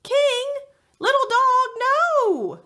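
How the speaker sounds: put-on girl yelling at dogs jumping overboard. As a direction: scream at the dogs to stop. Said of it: "Kayla yells".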